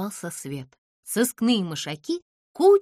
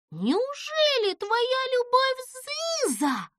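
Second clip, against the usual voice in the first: surprised; 14 semitones higher, slower than usual. A narrator's reading. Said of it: "удивлённо произнесла Чуча".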